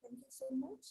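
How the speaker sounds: faint, far-off mic voice; speaking from too far from the mic; barely audible. Real, not acted. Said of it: "Ma'am, your voice is too low".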